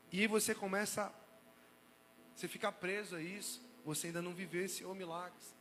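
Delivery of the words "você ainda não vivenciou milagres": ordinary talk, nothing marked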